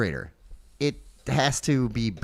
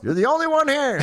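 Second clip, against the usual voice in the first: fridge barker voice; 13 semitones higher, 3 dB louder than usual. Pretend. Fridge voice answering.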